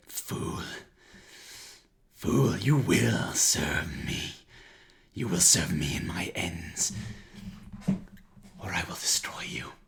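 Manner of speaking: loud, slow, hissing monster threat; menacing villain hiss. Acted.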